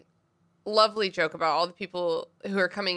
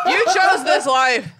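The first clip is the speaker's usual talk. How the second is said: high, loud, drawn-out mock protest; laughing accusation; 7 semitones higher, 8 dB louder, slower than usual. Real, not acted. Host throwing the long chapter back at co-host, laughing accusation. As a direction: cry it out slowly, almost laughing.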